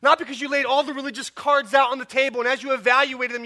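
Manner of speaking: impassioned pulpit voice; preaching at full force. Acted. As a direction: urge the congregation to be amazed. Sustained loud preaching.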